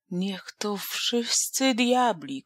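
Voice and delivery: slow grumbling oath while spitting water; sputtering curse. Acted. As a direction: curse under the breath, half drowned. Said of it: "klął Jemioł, parskając i plując wodą".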